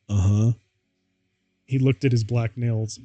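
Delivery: plain reading voice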